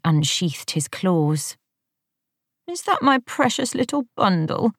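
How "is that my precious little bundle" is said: put-on high, mumbled baby-talk coo to the cat; sleepy wife cooing over her pet; drowsy doting coo. A narrator's reading. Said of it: "mumbled a voice".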